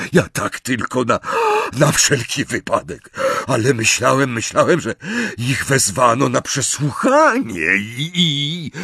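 halting, stammering backpedal; hesitant, intimidated speech; flustered excuses. A narrator's reading. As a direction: stumble nervously through an excuse.

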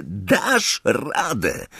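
hushed, very slow self-encouragement; a quiet hint of steeling himself; inner pep talk. Ron recalling his own thought mid-match, slow and hushed.

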